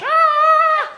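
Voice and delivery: very high, loud falling scream; comic scream. Retold or imitated. Comic falling on the banister, very high, loud scream. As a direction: let out a cartoon scream as you drop.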